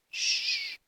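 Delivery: imitated hushing; shushing. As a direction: hush someone with a drawn-out shh. Hushing in retold dream.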